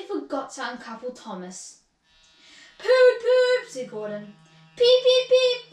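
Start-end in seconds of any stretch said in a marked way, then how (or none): 2.8-3.7 high, very loud train toots; loud engine toot. A narrator's reading. Gordon signals departure by whistle. 4.8-5.7 high, very loud train peeps; loud whistle peeps. A narrator's reading. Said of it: "whistled Thomas".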